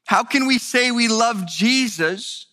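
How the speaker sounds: rising fervent sermon voice; impassioned preaching. Acted. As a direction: build the sermon to a passionate climax. Preaching builds to full force.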